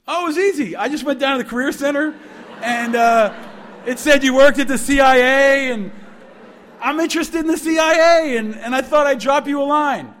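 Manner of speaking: put-on high, eager young caller; naive, eager student. Student cheerfully explaining his call to alum, naive.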